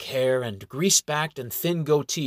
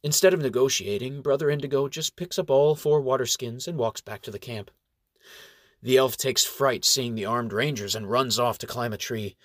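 ordinary delivery

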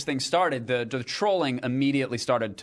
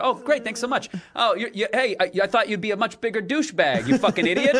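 mock fan voice; 7 semitones higher than usual. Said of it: "folks come up to me to say".

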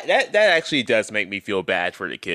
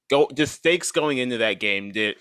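ordinary talk, nothing marked

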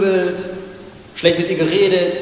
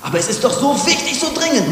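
high, fast protesting voice; mock objector; 7 semitones higher than usual. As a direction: play the busy person's excuse, high and quick. Imagined objection quoted.

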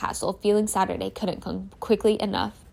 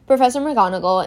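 usual speaking voice

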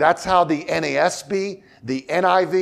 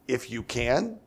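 questioning echo; 5 semitones lower, 9 dB quieter than usual. Shown as a demonstration. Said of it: "if it's meant to be a question mark".

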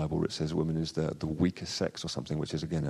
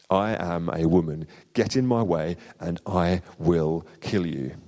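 fierce defiance. Acted. Eowyn defying the Witch King, fierce and slow. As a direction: stand your ground and threaten him.

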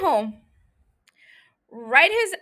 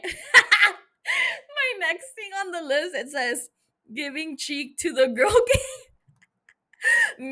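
laughing while reading out; reading through helpless laughter; cracking up; 3 semitones higher, much slower than usual. Real, not acted. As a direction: giggle through the words, higher. Cracking up reading her notes.

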